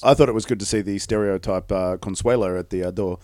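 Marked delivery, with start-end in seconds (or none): none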